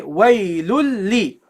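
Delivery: "Waylul li" slowly, long l held into li; doubled lam. Shown as a demonstration. Teacher pronounces second lam with kasra, doubled l. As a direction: hold the l of "Waylul li" across both syllables. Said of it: "So you say waylul li".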